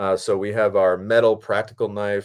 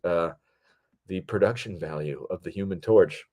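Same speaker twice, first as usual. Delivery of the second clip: ordinary delivery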